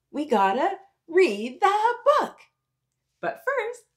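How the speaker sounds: rally the little readers; eager rallying call. Acted.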